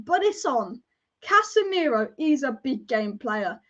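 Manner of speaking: ordinary talk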